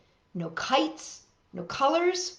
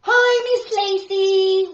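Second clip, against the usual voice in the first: loud, high, slow call; 10 semitones higher, 10 dB louder, slower than usual. A narrator's reading. Kids calling to last year's teacher in greeting. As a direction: call across the hall to her. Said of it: "we call to our teacher".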